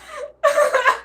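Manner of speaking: a sudden high cackle; high cackling laugh. Real, not acted. Host cracking up at dating apps, high cackle.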